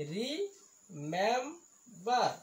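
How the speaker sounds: "Remember" in three slow, separate beats; syllable by syllable. Shown as a demonstration. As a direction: hold each syllable of "remember". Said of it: "ইয়াত তিনিটা চিলেবুল আছে". ("Remember" তিনিটা ভাগত ভাঙি লাহে লাহে)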